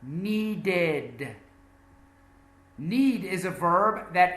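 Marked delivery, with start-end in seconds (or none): 0.0-1.3 "Needed" in two clear syllables, nee-did; ED as separate syllable. Shown as a demonstration. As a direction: say "Needed" with a full "id" ending. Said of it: "It's a separate syllable".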